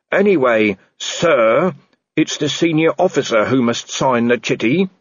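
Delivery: dutiful junior reporting to his boss; earnest constable. Acted. Constable Shu voiced in story.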